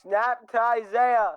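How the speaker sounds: play-by-play. Acted. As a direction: call the snap like an announcer. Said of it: "Let me do this play-by-play".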